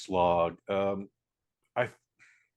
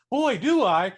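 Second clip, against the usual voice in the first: high, loud burst of mock eagerness; mock eager; 12 semitones higher, 9 dB louder, slower than usual. Conductor jumping at the job offer, mock eager. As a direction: act out an over-keen yes.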